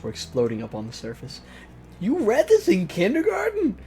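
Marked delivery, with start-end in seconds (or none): 2.0-3.8 incredulous shock. Real, not acted. Shocked reaction to kids' war story.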